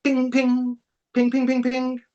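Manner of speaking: high-pitched mimicked notification pings; imitated phone pings. Telling the host how the phone lit up, high imitated pings. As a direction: chirp out the pings like a phone going off. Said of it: "my phone just went ping".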